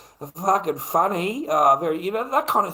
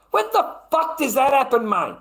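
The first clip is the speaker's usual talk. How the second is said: outraged; 9 semitones higher, 3 dB louder than usual. Real, not acted. Outraged rant.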